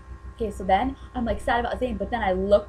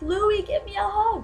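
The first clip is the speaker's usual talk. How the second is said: high, loud, drawn-out plea; reenacted fan call; 12 semitones higher, 5 dB louder than usual. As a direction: call out to your idol, stretching it. Replays calling out for a hug.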